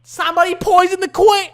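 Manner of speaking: high, shouted British alarm; hammed-up panicked British shout; bad British accent. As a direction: holler it in a terrible British accent. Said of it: "I cannot do British accents".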